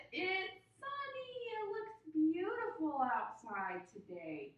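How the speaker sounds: P=A; excited reveal. Acted. Teacher discovers weather outside.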